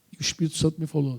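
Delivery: ordinary delivery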